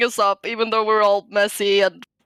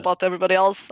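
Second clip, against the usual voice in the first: normal talking voice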